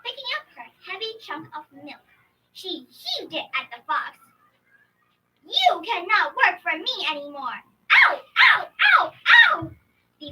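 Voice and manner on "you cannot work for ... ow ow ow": angry shout into yelps. Acted. Woman throws milk, fox cries.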